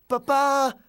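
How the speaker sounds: high, very loud call to dad; urgent shout from a little boy; desperate child's cry. Acted. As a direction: cry out to stop him. Child desperately stops father's hammer.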